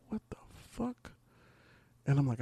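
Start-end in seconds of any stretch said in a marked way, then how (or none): 0.1-1.1 breathy whisper, stretched out slowly; whispered disbelief. Retold or imitated. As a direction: mouth it in shocked disbelief. Retold shock at app alert.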